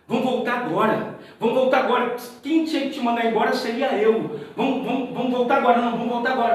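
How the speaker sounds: alto e agudo, insistindo para voltar; insistência aflita. Retold or imitated. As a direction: puxa ele de volta com urgência. (José insistindo para Emanuel voltar, alto e aflito)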